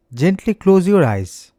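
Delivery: normal talking voice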